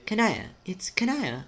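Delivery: distressed character. Acted.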